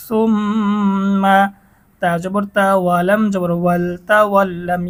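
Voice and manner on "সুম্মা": সুম্মা with long nasal ম্ম; ghunna on mm. Shown as a demonstration. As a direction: hum through the doubled ম. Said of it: "গুন্না করে পড়তে হবে". (ম্ম গুন্না করে)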